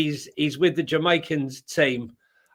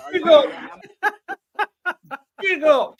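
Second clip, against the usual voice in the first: high, loud hammed-up "big up"; mock Jamaican accent; 12 semitones higher, 5 dB louder than usual. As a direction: bellow it like a cheery Jamaican. Imitating Jamaican greeting.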